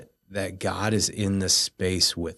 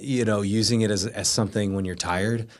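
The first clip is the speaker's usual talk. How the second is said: normal talking voice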